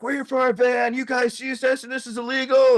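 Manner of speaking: high, sustained declaiming; mock protest. Mentor to counter staff about the van, high insistent protest. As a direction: imitate a protester calling out a demand.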